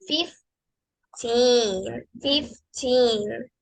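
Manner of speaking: put-on fifteen said slowly, the ee of teen held; slow, long teen. Shown as a demonstration. Teacher models the number fifteen slowly for the class. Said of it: "como tiene doble E, hay que alargarla".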